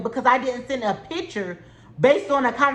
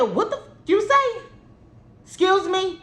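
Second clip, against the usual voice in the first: much higher, mock confrontational call-out; pitched-up retold confrontation; 7 semitones higher than usual. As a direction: recreate squaring up to her. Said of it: "I said".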